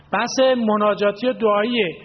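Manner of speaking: usual speaking voice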